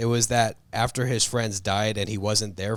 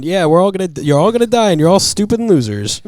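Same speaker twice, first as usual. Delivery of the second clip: louder, higher mock jeer; gruff Logan taunt; 7 semitones higher, 7 dB louder than usual. Friend retelling Logan jeering at the team, loud mock sneer. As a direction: sneer that they'll all die.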